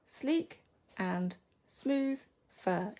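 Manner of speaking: Sleek, and, smooth, fur, each word set apart; slow, one beat each on Sleek ... and ... smooth ... fur; counting out syllables. Shown as a demonstration. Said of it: "Each one is a syllable".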